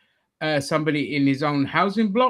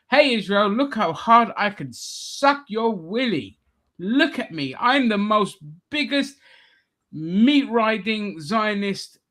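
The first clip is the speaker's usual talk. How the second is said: higher, drawn-out mock fawning; mock sycophant; 5 semitones higher than usual. Mocking politicians courting Israel, mock-sycophantic voice. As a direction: imitate a politician showing off eagerly. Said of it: "all this says to me is".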